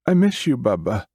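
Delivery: put-on very high, wistful child voice; little girl voice. Ghost child speaking.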